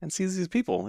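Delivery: ordinary delivery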